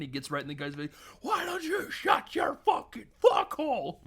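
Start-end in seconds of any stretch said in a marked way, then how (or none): 1.2-4.0 high, drawn-out screaming retort; furious outburst. Retold or imitated. Dog owner screaming in a stranger's face, mock furious. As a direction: imitate losing it completely, loud and slow.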